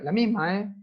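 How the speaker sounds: ordinary talk, nothing marked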